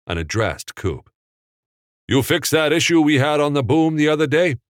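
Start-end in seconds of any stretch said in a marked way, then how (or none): 2.1-4.6 a film boss firing off a quick question, louder and higher; snappy director checking on the crew; brisk film director. A narrator's reading. Capra checks the boom repair with his crew, brisk and loud.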